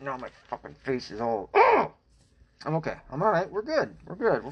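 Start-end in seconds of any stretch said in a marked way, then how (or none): 1.5-1.9 startled yelp. Real, not acted. Startled mid-sentence, then reassures.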